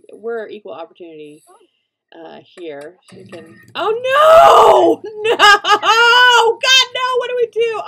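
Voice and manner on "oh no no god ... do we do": high, loud screaming panic at the fire; frantic yelling, voice cracking with laughter; panicked shriek. Real, not acted. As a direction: shriek in real alarm as the sim burns. Sim catches fire, real panic.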